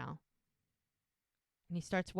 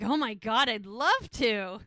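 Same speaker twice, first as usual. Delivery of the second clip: mock delight; 9 dB louder than usual. Lizzie thrilled by the rice-throwing plan, excited. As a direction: gush with eager glee. Lizzie eager to prank the printer.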